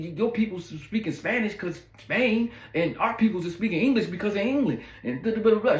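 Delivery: hammed-up heat, arguing over wine with a hurt date; getting loud. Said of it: "So I start getting loud too".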